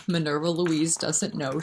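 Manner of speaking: ordinary delivery, nothing marked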